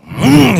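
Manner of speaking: high, loud vocal vroom; engine revving noise. Retold or imitated. Preacher mimicking driving on regardless, loud engine vroom. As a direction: mimic a car engine surging. Imitates car revving as drove off.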